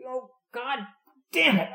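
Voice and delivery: high cartoonish whine of frustration; whiny curse. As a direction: imitate the mechanic cursing a stuck bolt. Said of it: "he had sort of a high, whiny voice".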